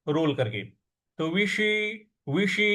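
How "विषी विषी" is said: विषी with the tongue curled back to the roof for ष; retroflex ष. Shown as a demonstration. Teacher shows the tongue position for ष in विषी. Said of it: "इस प्रकार से बोलेंगे".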